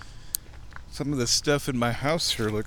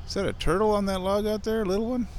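curious surprise; 7 semitones higher than usual. Real, not acted. Spotting a turtle.

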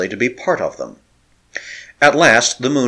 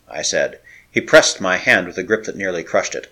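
usual speaking voice

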